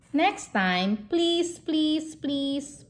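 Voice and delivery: high, begging pleases; pleading. Acted. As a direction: beg with rising voice. Pleading with child.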